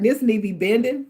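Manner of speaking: ordinary delivery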